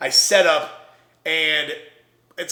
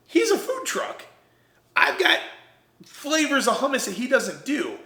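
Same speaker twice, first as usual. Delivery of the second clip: insistent pitch; 9 semitones higher than usual. Retold or imitated. Pitching himself to market managers.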